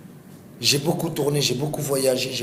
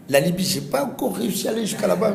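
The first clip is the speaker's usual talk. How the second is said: laughing; 6 semitones higher, faster than usual. Real, not acted. Laughs about Libya.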